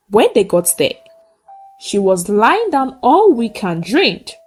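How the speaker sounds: usual speaking voice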